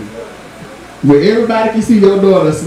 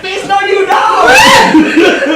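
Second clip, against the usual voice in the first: slow shouted retort; 11 semitones higher, 5 dB louder, much slower than usual. Retold or imitated. Retorting to mama in retold call.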